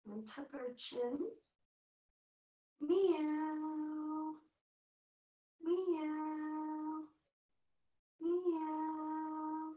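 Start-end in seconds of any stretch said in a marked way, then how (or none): 2.8-9.7 high, drawn-out pretend meow; playful kitty meow, higher; cat meow. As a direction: meow like a cat for little ones. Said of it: "like an angry cat".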